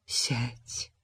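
soft, whispered command; gentle. A narrator's reading. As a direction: ease him down tenderly. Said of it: "мягко сказала она".

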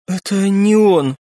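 slow, subdued correction; understated grief, words stretched; hollow answer. A narrator's reading. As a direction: answer it dully, drawn out. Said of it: "глухо ответила девушка".

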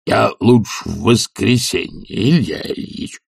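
slow, drawn-out plea; reluctant, dragging appeal; pleading servant. A narrator's reading. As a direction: stall your master wheedlingly. Zakhar pleading to postpone.

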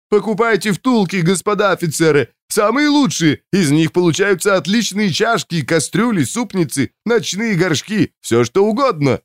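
high-pitched market hawker's patter; whining street vendor. A narrator's reading. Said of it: "нудил какой-то торговец".

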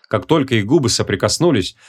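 plain reading voice

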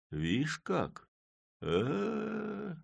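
high drawn-out bewildered sound; dumbfounded Chub. A narrator's reading. Chub speechless at the mayor, drawn-out and high. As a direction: gape in disbelief.

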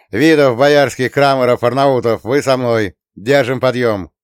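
loud roll-call command; sergeant's orders. A narrator's reading. Sergeant organizes the men, shouting orders. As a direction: rap out names like a sergeant.

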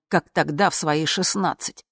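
plain reading voice

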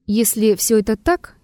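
ordinary delivery

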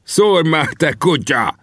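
high-pitched impatient yell; sharp, high goading cry; angry shout. A narrator's reading. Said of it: "kiáltott rám".

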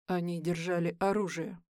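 low and soft, cold; quiet. Acted. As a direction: answer quietly, unmoved. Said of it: "тихо отвечает он".

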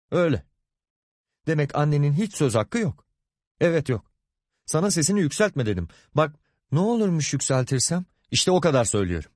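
tiz perdeden meydan okuma; kızışan ağız dalaşı. Acted. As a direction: sesini incelterek inatla karşılık ver. Karı koca kavgası kızışıyor. (öfkeli karı koca atışması)

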